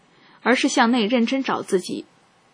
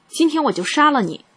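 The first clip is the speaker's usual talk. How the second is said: higher-pitched, restrained narrated yell; angry shouted threat; 6 semitones higher than usual. A narrator's reading. Husband threatens his wife with a kitchen knife, restrained shout. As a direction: read the threat raised, short of shouting. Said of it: "对着我的脸喊叫".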